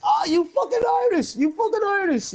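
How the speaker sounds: hammed-up thrilled Harlem kid; mock excited local. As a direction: ham up the kid's delight. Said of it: "he turned around and he says".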